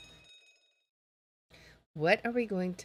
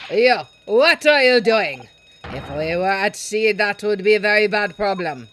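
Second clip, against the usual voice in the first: crewman shouting; 10 dB louder than usual. Acted. Crewman yells at disturbance.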